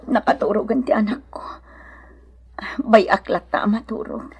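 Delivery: put-on soft, half-whispered so the child sleeps; whispering beside a sleeping child; hushed mother. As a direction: keep it down, the baby is asleep. Child fell asleep.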